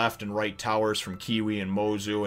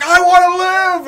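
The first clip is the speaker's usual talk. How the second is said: very high, loud drawn-out wail; tearful cry; 14 dB louder, slower than usual. Retold or imitated. Robin crying out to her crew at last, wailing loudly. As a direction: imitate a desperate, crying scream. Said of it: "she's crying".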